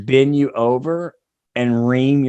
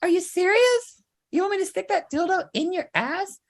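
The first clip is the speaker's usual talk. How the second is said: very high falsetto, softer and shocked; shocked falsetto wife; 4 dB quieter than usual. Acted. Wife reacting to her husband, high shocked falsetto. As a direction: squeal in disbelief as the wife.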